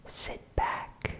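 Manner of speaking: usual speaking voice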